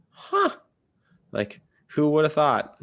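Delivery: high "huh" of mild wonder; wondering, high-voiced aside; retold surprise. Retold surprise at meeting friends.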